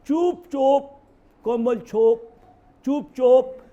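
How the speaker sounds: punchy Bengali slogan imitation; mimicked slogan. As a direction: call out a politician's catchphrase, mocking it. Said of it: "Rahul से भी यही कहते".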